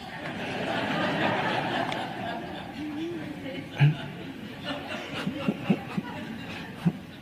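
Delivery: a long, high, breathy laugh; big laugh. Real, not acted. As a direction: laugh at the absurdity. Laughter after mock advice.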